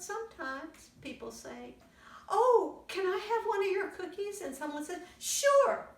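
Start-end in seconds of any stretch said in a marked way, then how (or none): 2.3-4.4 put-on high, quick kid voice; high child voice. Child asking again. 5.2-5.9 bright high happy yes; high cheerful. Retold or imitated. Cheerful sharing.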